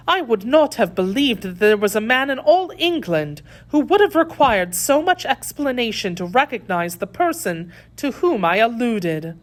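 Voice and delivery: high-voiced, emphatic reproach; lady's incredulous scorn; indignant lady prisoner. A narrator's reading.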